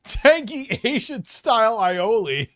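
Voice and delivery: loud, high, slow savoring of the name; loud drawn-out announcement. Real, not acted. Streamer reading out a donor's name loudly and slowly. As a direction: relish the silly name.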